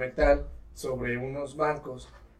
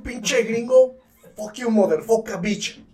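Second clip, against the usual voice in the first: higher and louder, hammed-up insults; mock angry yell; 6 semitones higher, 4 dB louder, slower than usual. Friends mock the pushy gringo, hammed-up yell. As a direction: tell off the gringo in mock fury.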